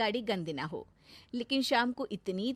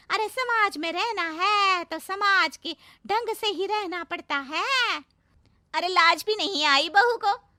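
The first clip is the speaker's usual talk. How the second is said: high, loud, outraged gossip voice; indignant village gossip; 9 semitones higher, 8 dB louder than usual. Acted. Gossiping neighbour rants about the bride riding, high and loud. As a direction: stir up scandal about the bride.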